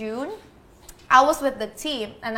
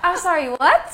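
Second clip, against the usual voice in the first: mock-baffled, higher-pitched double take; 5 semitones higher, 4 dB louder than usual. Hosts asking her to repeat her name, baffled. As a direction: ask it with a laugh, totally lost.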